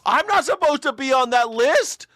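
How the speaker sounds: a doomed man begging, hammed up; panicked protest. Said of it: "He's, like, screaming".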